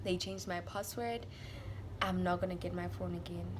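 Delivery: low, very soft and hopeless; defeated, tearful giving up. Retold or imitated. Crying to boyfriend that it's over, retold soft despair. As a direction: let it sink low and quiet, resigned. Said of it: "I cried".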